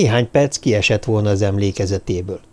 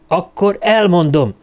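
angry; 7 semitones higher than usual. A narrator's reading. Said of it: "ütött az asztalra Lamartine".

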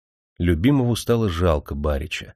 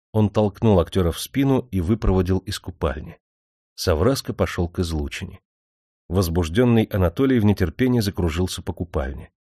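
plain reading voice

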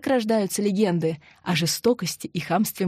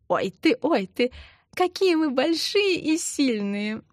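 put-on cutesy baby talk; 9 semitones higher than usual. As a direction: coo sweetly at the baby. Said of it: "Засюсюкала, акая".

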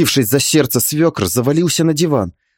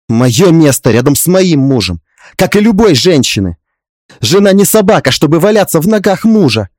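higher, louder, resolute retort; firm defiance; 3 semitones higher, 5 dB louder than usual. Acted. Daughter-in-law standing up to father-in-law, firm and defiant. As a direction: answer back firmly, rising in pitch. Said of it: "Твёрдо встречая его взгляд, ответила я".

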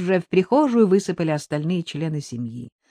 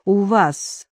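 mildly resigned; understated, fatalistic acceptance; resigned sighing; 2 semitones higher, much slower than usual. A narrator's reading. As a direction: give in with a touch of doom. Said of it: "обречённо вздохнула Дуся".

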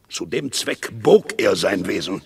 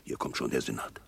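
leise, tonlos zum Schweigen mahnen; flüsternd abbrechen, der Senat kommt; gezischte Warnung; 12 semitones lower, 12 dB quieter, faster than usual. Acted. Senat naht, Verschwörer verstummen. (Verschwörer mahnt den Mitverschwörer flüsternd zu schweigen)

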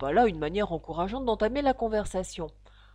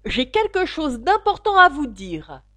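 loud, higher urgent call; 7 semitones higher, 10 dB louder than usual. A narrator's reading. Caterpillar calling departing Alice back, shouting. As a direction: shout it after someone walking away. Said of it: "lui cria la chenille".

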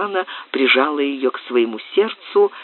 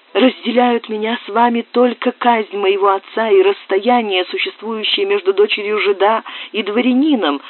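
put-on higher agitated girl's voice; young woman Susanna; 7 semitones higher than usual. Susanna confiding in the lord, higher pleading voice. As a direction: plead as a frightened young girl.

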